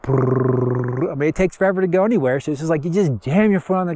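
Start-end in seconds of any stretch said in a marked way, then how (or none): 0.0-1.1 lip-trill motor noise; imitated sputtering car motor; slow car engine. Shown as a demonstration. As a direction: buzz like a sluggish little engine. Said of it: "they're like this".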